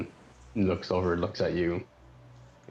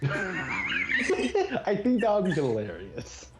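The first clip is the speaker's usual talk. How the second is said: high-pitched, drawn-out laughing words; laughing delight; 12 semitones higher, much slower than usual. Real, not acted. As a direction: talk through a big laugh. Laughing at suggested roll.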